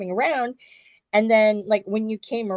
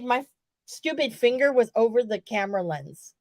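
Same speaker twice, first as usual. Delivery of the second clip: usual speaking voice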